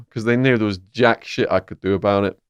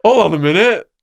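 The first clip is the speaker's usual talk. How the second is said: alarmed reaction; 9 semitones higher, 4 dB louder than usual. Retold or imitated. Prisoner balking at chess with a mafia murderer, alarmed. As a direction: act out being taken aback, pitch well up.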